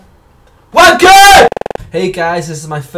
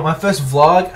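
ordinary talk, nothing marked

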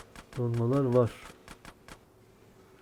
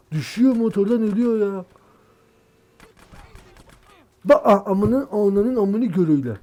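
loud, higher yelling at the screen; angry yell; 8 semitones higher, 9 dB louder than usual. Real, not acted. Gamer watching his rider fall, angry yell. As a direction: blow up at the game. Character keeps dying on motorbike.

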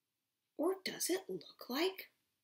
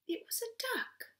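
whisper; 12 semitones higher, 3 dB quieter than usual. Acted. Whispered aside.

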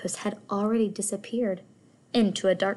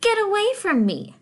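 high, louder proud outburst; indignant cry; 12 semitones higher, 6 dB louder than usual. Acted. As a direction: snap back at the little fish. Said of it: "cried the Rainbow Fish".

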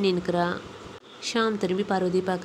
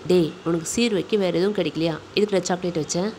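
plain reading voice

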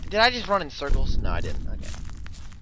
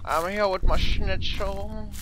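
high, drawn-out mock German greeting; 4 semitones higher, slower than usual. Player offering Slender schnitzel, high mock German. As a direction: sing out a hammed-up German accent. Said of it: "So Marge is now German".

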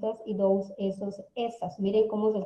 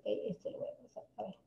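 hushed, voiceless self-talk; muttered aside; 13 dB quieter than usual. Real, not acted. Teacher skipping an item to herself, muttering. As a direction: say it under your breath.

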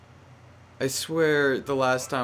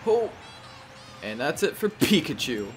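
normal talking voice

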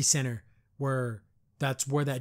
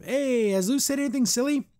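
high, put-on cheery call; voiced character question; 11 semitones higher than usual. Camilla showing up to ask about Luz, high put-on voice. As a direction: pitch it up sharply as a put-on voice.

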